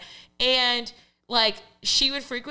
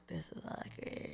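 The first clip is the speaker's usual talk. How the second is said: breathy whisper of the real thought; whispered aside; 4 semitones lower, 19 dB quieter than usual. Retold or imitated. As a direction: whisper it like a secret inner voice. Inner thought of contestant.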